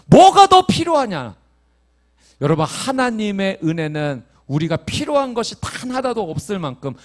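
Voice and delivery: high, loud fervent preaching; preaching at full force. Acted. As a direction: proclaim it, lifting the whole room. Preacher proclaiming grace.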